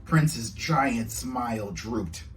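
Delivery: ordinary delivery, nothing marked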